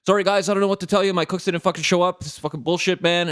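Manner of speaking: high, fast ranting owner apology; frantic swearing owner. Retold or imitated. Manic restaurant owner ranting to his customers, high and fast. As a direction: rattle it off high and fast like a frazzled boss. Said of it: "he's like".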